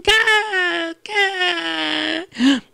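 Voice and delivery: high cawing; crow caws. Acted. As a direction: caw like a crow. Mimicking crows.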